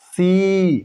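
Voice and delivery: सी with the ee drawn out long; long ee in see. Shown as a demonstration. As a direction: stretch the ee in सी. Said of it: "लंबा करते हैं". (ई लंबा खींचकर सी)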